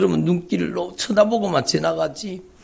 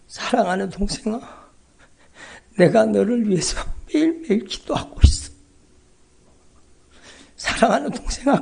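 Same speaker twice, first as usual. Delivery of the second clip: tender, reassuring sister on the phone; lightly voiced caring older sister; comforting sister. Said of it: "그랬더니".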